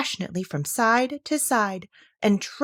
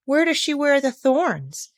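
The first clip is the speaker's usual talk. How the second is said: high, slow, curious question; 8 semitones higher, 3 dB louder, slower than usual. A narrator's reading. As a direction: ask with wide-eyed interest. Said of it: "with some curiosity".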